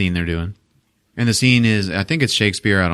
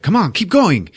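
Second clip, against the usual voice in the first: higher, louder push to keep going; loud urging; 10 semitones higher, 3 dB louder than usual. Retold or imitated. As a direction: egg him on in front of everyone. Tommy urging Greg in restaurant.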